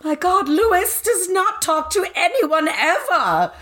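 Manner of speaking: high, slow, scandalised exclaim; high gasping gossip. Retold or imitated. Friends retelling a novel, shocked high gossip voice. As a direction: gasp the news like a shocked gossip. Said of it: "everyone's like".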